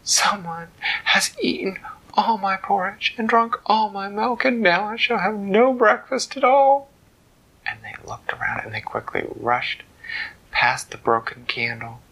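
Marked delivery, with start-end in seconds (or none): 0.0-6.9 higher, prim dismayed lady; a fussy mother outraged over breakfast; high, put-out mama voice; Mama Bear voice. Acted. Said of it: "And Mama said".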